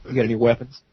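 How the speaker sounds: low, soft deadpan question; imitated deadpan uncle; straight-faced. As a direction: ask it in dead seriousness, dropped low. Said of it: "Like, straight face".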